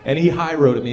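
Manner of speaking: ordinary talk, nothing marked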